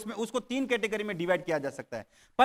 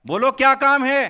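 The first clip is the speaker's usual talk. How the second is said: loud demand; 5 semitones higher, 9 dB louder than usual. Acted. Ghost demands work.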